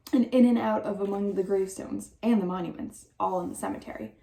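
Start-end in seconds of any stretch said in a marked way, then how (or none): none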